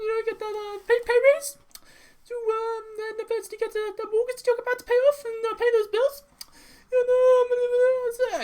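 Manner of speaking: very high-pitched, loud cartoon baby voice; badly faked Stewie, squeaky and halting; Stewie impression. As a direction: put on a piercing, hesitant little-boy villain voice. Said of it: "it's my terrible Stewie impression".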